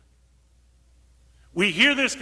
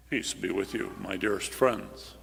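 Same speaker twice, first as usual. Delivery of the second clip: a sanitized, pious Jesus, much lower; solemn mock Jesus; 11 semitones lower, 4 dB quieter than usual. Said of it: "very solemnly saying".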